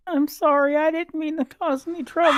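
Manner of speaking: put-on little girl apologizing to Caradoc; tearful little girl. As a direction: apologize as a crying child.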